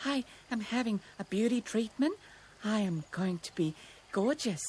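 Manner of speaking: self-pleased rabbit, out of breath; panting rabbit. A narrator's reading. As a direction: pant it out in little phrases. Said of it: "Roxy panted".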